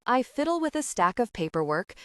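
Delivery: ordinary delivery, nothing marked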